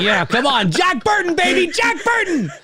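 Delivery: very high, loud pumped-up yell; hyped-up cheering. Retold or imitated. Fan voices the hero all set for action, hyped yelling. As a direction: whoop it like a hero psyching up.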